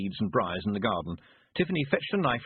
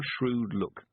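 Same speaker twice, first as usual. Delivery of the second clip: ordinary delivery, nothing marked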